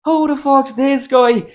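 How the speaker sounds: high, loud, slow mock Irish; McGregor. Repeating his impression for his mate, mock Irish accent. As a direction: draw it out in an exaggerated Irish brogue. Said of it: "I was doing McGregor".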